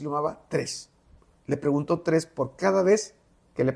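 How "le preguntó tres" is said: usual speaking voice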